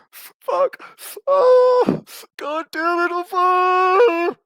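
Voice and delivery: very high, loud hammed-up crying wail; mock sobbing. Mocking a crying viewer pretending to be fine, hammed-up high sobbing. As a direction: fake a breakdown, sobbing through the words. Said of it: "I'm not gonna be like".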